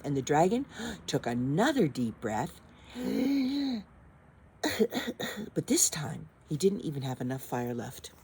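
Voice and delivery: ordinary delivery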